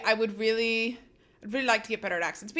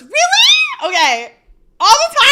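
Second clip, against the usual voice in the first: very loud, high-pitched shrieked reaction; excited outburst; 16 dB louder than usual. Real, not acted. Streamer reacting to talk of shower monologues, excited shrieking. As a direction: shriek it through laughter.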